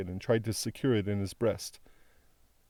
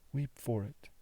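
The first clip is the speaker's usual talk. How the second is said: the echo dropped much softer; fading softer repeat; 2 semitones higher, 8 dB quieter than usual. A narrator's reading. Daughter repeating her plea to her father, softer fading echo. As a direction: let the repeat die away.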